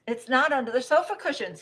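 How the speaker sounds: mildly frantic rabbit crying out; panicked cry. Acted. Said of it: "he cried".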